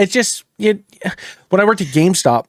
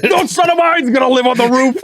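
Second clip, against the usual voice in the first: high, hammed-up holler; mock parent shout; 10 semitones higher, 4 dB louder than usual. Parent scolding a son, high mock shout. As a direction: shout it like an outraged comic parent. Acting out a dad with accent.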